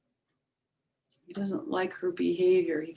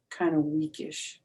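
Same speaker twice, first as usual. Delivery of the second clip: ordinary delivery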